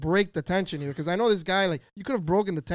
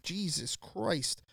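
slow, softer mock-stunned oath; stunned reaction; 8 dB quieter, much slower than usual. Customer hearing the clerk's frank language, slow stunned oath. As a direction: draw it out quietly, taken aback.

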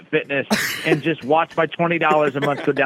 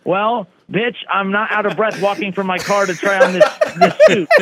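high, laughing mock comeback, louder at the end; laughing through it; 6 semitones higher, 5 dB louder than usual. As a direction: blurt the retort while cracking up. Retold comeback to tailor, laughing.

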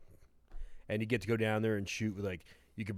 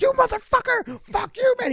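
screaming high abuse at the host; angry caller; 11 dB louder than usual. Retold or imitated. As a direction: yell it like a furious prank caller. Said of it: "they'd be like".

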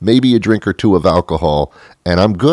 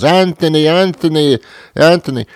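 mock yell; 10 semitones higher, 3 dB louder than usual. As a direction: call your kid from an upstairs window. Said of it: "and she yells".

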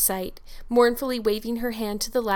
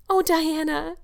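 high-pitched exclamation, as read aloud; crying out; 8 semitones higher, 4 dB louder, slower than usual. A narrator's reading. Anne to Diana, clasping her hands, crying out. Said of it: "cried Anne".

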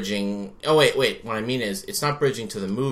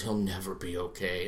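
low, soft, solemn pronouncement; mock grave; 4 semitones lower, 10 dB quieter than usual. Priest answers whether he's okay now, mock grave. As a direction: deliver it as an understated doom-laden verdict.

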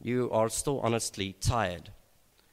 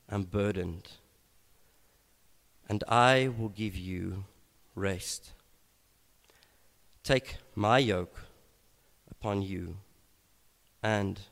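slow reading; slower than usual. Shown as a demonstration. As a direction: let each phrase settle before the next. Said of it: "I want to do so very slowly".

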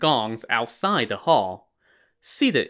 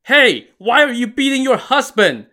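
shrill high exclaim, loud and pained; high yelp; 12 semitones higher, 6 dB louder than usual. A narrator's reading. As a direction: yelp it out in protest. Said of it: "the chieftain exclaimed".

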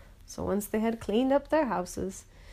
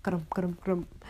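a grumbling monkey going grump, grump; grumpy monkey; slower than usual. Retold or imitated. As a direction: mutter it like a grouchy little monkey. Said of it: "and he'd go".

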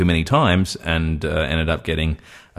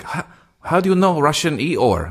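much higher put-on voice, questioning; imitated Russian woman; 10 semitones higher than usual. Retelling his date's reaction to Russian Eeyore, imitated surprised Russian woman. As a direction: mimic her puzzled question, pitched up.

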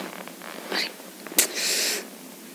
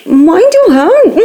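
louder, slow playful warning; 5 semitones higher, 8 dB louder, much slower than usual. Acted. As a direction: stress your, drawn out.

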